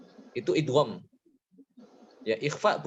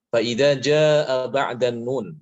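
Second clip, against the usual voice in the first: slow, louder reading of the Arabic text; slow recitation; 6 dB louder, much slower than usual. Shown as a demonstration. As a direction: recite the rule carefully. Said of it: "reads rule text in Arabic".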